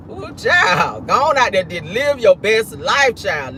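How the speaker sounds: usual speaking voice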